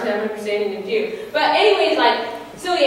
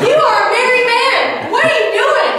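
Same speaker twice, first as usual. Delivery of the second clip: indignant rant; 12 semitones higher, 6 dB louder than usual. Real, not acted.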